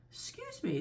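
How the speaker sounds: put-on high, polite young elephant voice; polite child. Elephant's child addresses the crocodile, high and polite. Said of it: "most politely".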